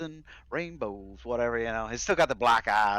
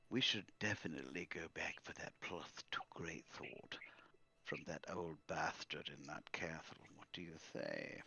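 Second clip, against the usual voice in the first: conspiratorial whisper, much softer; 6 semitones lower, 15 dB quieter than usual. Acted. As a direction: hush it like a secret plan. NPC secretly plots in whisper.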